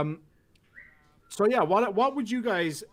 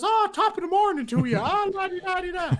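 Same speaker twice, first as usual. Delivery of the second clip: high, loud, lilting mock Irish; over-the-top Jolly Irishman accent, sing-song and loud; stage-Irish caricature; 13 semitones higher, 5 dB louder than usual. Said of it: "that's what everybody thinks Irish people sound like".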